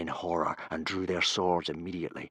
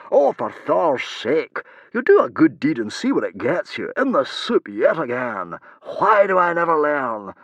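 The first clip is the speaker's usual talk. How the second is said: exasperated; 7 semitones higher, 8 dB louder than usual. A narrator's reading. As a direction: wail about your own bad luck. Exasperated man complains after accusation.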